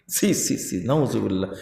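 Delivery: soft tutting, then a low muttered Nauzubillah; disgusted tut-tut. Real, not acted. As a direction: click the tongue in dismay. (জিভ চুকচুক করে বিরক্তি)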